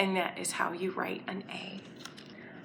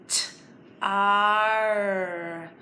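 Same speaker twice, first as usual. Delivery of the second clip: normal talking voice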